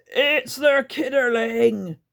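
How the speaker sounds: put-on a creature spotting the girl; croaked. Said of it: "Grunt croaked".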